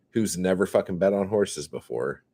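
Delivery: ordinary talk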